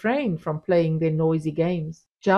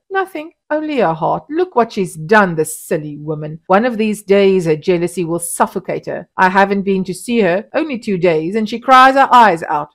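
louder, scornful; dismissive; 8 dB louder than usual. A narrator's reading. Husband dismissing his wife's collapse to the women, scornful. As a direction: brush it off.

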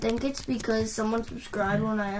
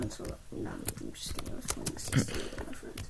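whispered aside; 11 dB quieter than usual. Acted. Joking aside, unvoiced.

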